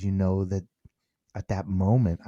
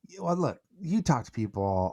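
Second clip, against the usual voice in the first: ordinary talk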